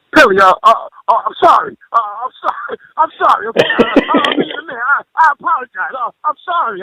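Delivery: high, stammering imitation of a panicked friend apologizing; flustered apology. Imitating friend's flustered apology after guilt trip.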